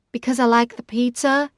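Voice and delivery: dry, mocking joke answer; sarcastic. A narrator's reading. Sister dodging the question with a sarcastic joke. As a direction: toss off a sarcastic excuse. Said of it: "I answer sarcastically".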